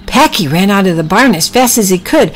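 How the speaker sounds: ordinary delivery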